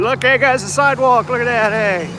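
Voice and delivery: high, delighted discovery; excited. Real, not acted. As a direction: point it out with glee. Excited to find sidewalk.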